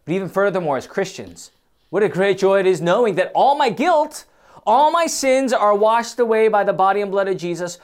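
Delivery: ordinary delivery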